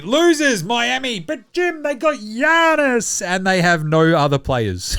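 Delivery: high mocking whine, slowing into a laugh; mocking fan voice. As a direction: play the deluded fan, half laughing. Mocking Miami fans' defence.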